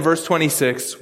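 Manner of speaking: ordinary talk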